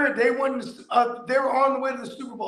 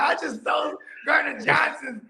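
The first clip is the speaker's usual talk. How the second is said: bragging car thief; 6 semitones higher than usual. Retold or imitated. Voices the thief's Instagram brag.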